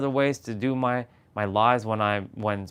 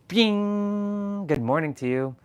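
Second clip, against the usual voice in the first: bright high "Bing" then easy greeting; chirpy greeting; 10 semitones higher, much slower than usual. Retold or imitated. Narrator greeting household despite sleeping dad, chirpy. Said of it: "I'm gonna be like".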